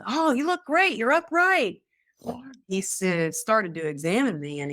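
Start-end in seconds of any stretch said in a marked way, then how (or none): 0.0-1.8 high, loud, gushing praise; imagined delighted doctor. Pretend. Imagining the doctor praising her progress, mock delight. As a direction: cheer like an overjoyed doctor. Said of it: "I was thinking he was gonna be".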